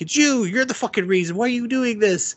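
high-pitched mock rant; hammed-up, squealed exasperation at someone; exasperated outburst. Uncle berating someone over subscribers, mock exasperated.

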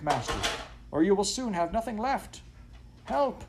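high, yelping dog's plea; frantic alarm. A narrator's reading. Dog urging master to act, frantic alarm. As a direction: wail the bad news at a high pitch, crying out for help.

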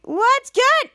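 high, loud chant lead; rallying call. Retold or imitated. Henry leading the kids' chant, rallying call. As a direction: call it out for the team to answer. Coach leads a team chant.

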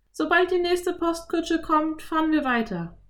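put-on high girl voice, smiling; a smiling little girl answering; Anne, smiling. A narrator's reading. (Mädchenstimme, lächelnd)

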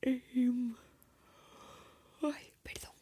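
yawning mid-sentence. Real, not acted. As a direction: talk through a yawn. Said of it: "estoy bostezando".

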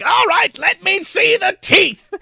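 high, slow theatrical command; mad scientist. Retold or imitated. Doctor demanding the T-Rex's teeth, hammed-up mad scientist. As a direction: ham up a crazed doctor's order.

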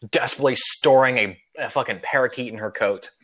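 ordinary talk

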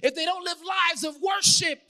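high, loud, driving sermon; urgent preacher pushing through each clause; full-force preaching. Real, not acted. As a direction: build a list of warnings to a peak.

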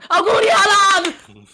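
birthday shout. Real, not acted. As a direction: shout the wish out slowly. Loud birthday wish to friend.

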